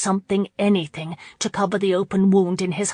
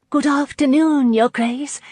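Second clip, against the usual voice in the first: high, louder lilting farewell; sing-song; 8 semitones higher, 5 dB louder than usual. A narrator's reading. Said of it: "called in a sing-song voice".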